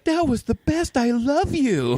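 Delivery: high mock swoon, slowing at the end; imitated movie woman. Movie woman to Lewis afterwards, high mocking adoration. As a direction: gush it in a high, drawn-out mock love voice. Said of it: "And then she's like".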